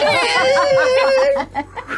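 loud high comic squeal; long high-pitched mimicked cry; spinning creature's wail. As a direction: wail like a tiny creature in a whirlwind. Imitating spider spinning in wind.

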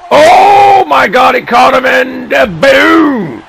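very high, loud, stretched-out yell; excited shout. Real, not acted. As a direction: scream it out in sheer disbelief. Commentator reacting to a big catch.

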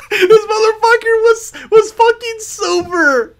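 high, laughing, incredulous yell; hysterical disbelief. Real, not acted. Gamer ranting that his opponent was silver, hysterical disbelief. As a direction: shriek it through helpless laughter.

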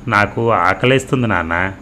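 ordinary delivery

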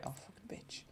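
unvoiced whispered curse; whispering. Real, not acted. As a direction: hiss the curse under your breath. Swearing under breath at game.

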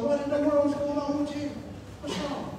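hushed, high voice imitating an anxious wife; concerned wife. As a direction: gently ask what is the matter. Wife finds him crying, voiced softly.

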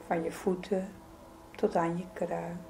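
ordinary delivery

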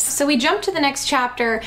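usual speaking voice

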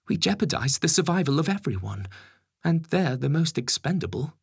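high, insistent Home Secretary. Acted. Home Secretary warning the Prime Minister, high and insistent.

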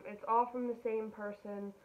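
normal talking voice

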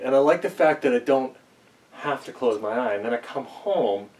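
usual speaking voice

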